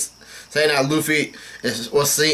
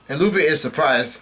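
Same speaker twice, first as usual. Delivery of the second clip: usual speaking voice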